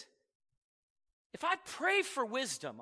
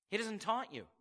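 ordinary talk, nothing marked

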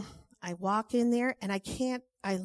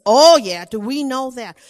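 emphatic; 2 semitones higher, 15 dB louder than usual. Retold or imitated. Oliver reacts to the news.

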